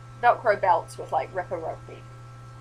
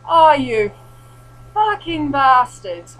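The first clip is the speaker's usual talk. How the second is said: loud, slow, higher cursing; exasperated swearing; 6 semitones higher, 11 dB louder, much slower than usual. Retold or imitated. At a pack of waiting zombies, a loud, slow curse. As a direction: drag out the curse at the ambush.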